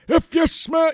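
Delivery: high, loud Rock catchphrase; hammed-up wrestling catchphrase. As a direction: belt it like The Rock on the mic. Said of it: "you know, like that".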